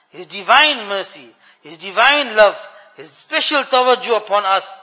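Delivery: loud, fervent sermon delivery; swelling religious exhortation; impassioned preaching. Real, not acted. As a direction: preach it with force, leaning into each phrase. Preaches on divine mercy.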